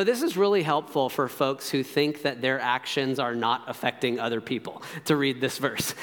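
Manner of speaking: usual speaking voice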